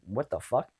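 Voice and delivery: ordinary talk, nothing marked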